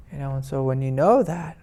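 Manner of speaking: ordinary talk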